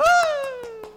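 high loud mock crowd cheer; crowd roar imitation. Preacher imitating a cheering crowd, loud and high. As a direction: roar like the whole room cheering.

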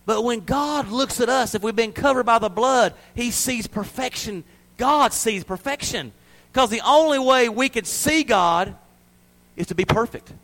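high, loud, emphatic sermon delivery; impassioned preaching. Real, not acted. Preacher assuring believers that God sees perfection, impassioned preaching. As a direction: lift the voice high and drive the point home.

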